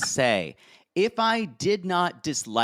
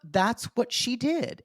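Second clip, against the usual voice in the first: ordinary talk, nothing marked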